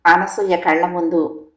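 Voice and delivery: plain reading voice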